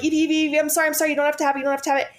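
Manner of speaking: high, rapid-fire pleading reassurance, re-enacted; retold anxious apology in a high, pressing voice; frantic mom soothing. Mom trying to calm her screaming daughter, high and frantic. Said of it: "so upset and so worried for her".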